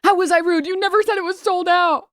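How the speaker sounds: high, loud mock outrage; retold all-caps shout. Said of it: "And then all caps".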